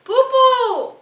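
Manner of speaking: high, loud silly squeal. Real, not acted. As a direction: squeal it like a joke. Teasing friend's silly noise.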